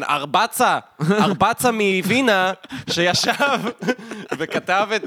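loud, slow, grand rabbi title; mock rabbinic title. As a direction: intone it like a revered rabbi. Mocking rabbinic acronym names. (תואר רבני בטון חגיגי)

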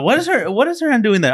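high-pitched imitation of an alarmed mom; questioning mother. Son imitating mom questioning a photo, alarmed. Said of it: "She's like, she's like".

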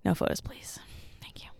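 hushed mock celebrity whisper. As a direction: whisper it like dodging paparazzi.

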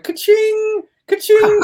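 very high, squeaky mock impression; Jordan Peterson. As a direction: sing out in a strained falsetto. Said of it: "his Peterson impression".

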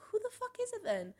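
high-pitched, baffled demand; retold exasperation. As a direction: act out being fed up with guessing. Retold exasperation at guessing game.

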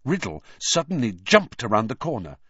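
ordinary delivery, nothing marked

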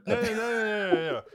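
high, loud rapid no's; excited protest. Real, not acted. Protesting the robot idea.

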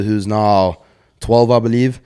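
ordinary talk, nothing marked

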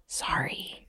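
silent mouthed apology, barely breath; just mouth the word; mouthed sorry. Acted. Sister apologizing across to Duval, mouthed sorry. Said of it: "she's gonna kind of just mouth".

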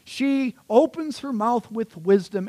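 high, loud, slow proclamation; hyperbolic superhero reading. Shown as a demonstration. Preacher reads of the superhero woman, grand exaggerated proclamation. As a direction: announce it like a superhero's power. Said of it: "I'm reading a certain way".

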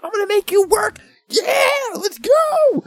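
very high, loud mock cheer; hyped-up hollering. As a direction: whoop it up, stretching the last words. Retold hype before the shrine.

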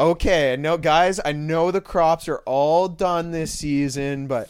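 loud, slowing address to the crowd; rally the villagers with slow, hammed-up emphasis; mock village leader. Acts leader pitching a sacrifice to villagers.

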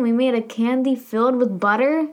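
ordinary talk, nothing marked

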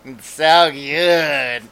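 very slow, stretched relish; exaggerated lingering delight; hammy movie villain imitation. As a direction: draw every word out, over-acting. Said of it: "he was hamming that up".

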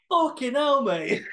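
loud mock accent of a coach swearing in a voice note; Max's accent. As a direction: blurt it out in a put-on broad accent, way up high. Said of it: "in that fucking accent".

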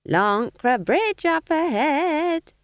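put-on high, slow, sly old woman; sly smiling innocence. A narrator's reading. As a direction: feign innocence through a smile, pitched high, slow. Nanny changes subject.

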